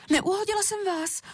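put-on high, concerned little-girl voice; apologetic child. A narrator's reading. Šárka checks on paní Zelinková, apologetic. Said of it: "omlouvala se Šárka".

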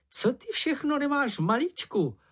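high, lightly voiced call full of wonder; a touch of excited awe; admiring call. A narrator's reading. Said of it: "volal Čenich s obdivem".

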